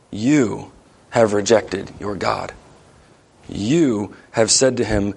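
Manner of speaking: each "you" stretched out, then a pause; slow, weighty stress on "you"; emphatic you. Retold or imitated. Preacher quoting Samuel's charge to the people, emphatic you. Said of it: "that's an emphatic grammatical you".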